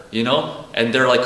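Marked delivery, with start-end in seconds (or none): none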